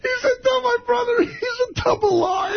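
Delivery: high-pitched, laughing through the words; can barely get it out for laughing; laughing hard. Real, not acted. Telling the crew about the prank call, laughing helplessly.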